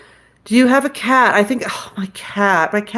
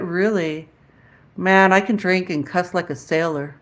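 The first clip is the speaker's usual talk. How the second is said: ordinary talk, nothing marked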